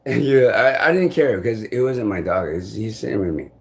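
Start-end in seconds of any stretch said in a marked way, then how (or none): none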